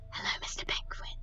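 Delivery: whispered hello to a toy; soft secret greeting. Pretend. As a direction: breathe it to the penguin.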